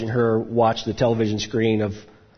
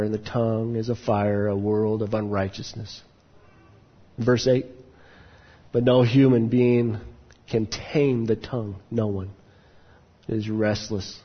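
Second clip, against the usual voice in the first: scripture reading. Acted. Reads James chapter three.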